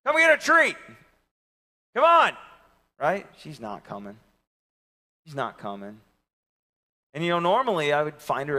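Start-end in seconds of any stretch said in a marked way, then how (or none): 0.1-2.4 high loud call; yelling out the window. Retold or imitated. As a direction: call the dog from a truck. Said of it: "yelling out the window".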